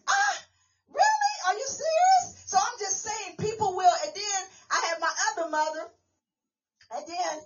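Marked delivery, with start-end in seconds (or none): 0.1-2.3 high squeal of delighted surprise; re-enacted giddy, high-pitched amazement; excited disbelief. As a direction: squeak it out, thrilled by the offer. Acting out delighted reply to offer.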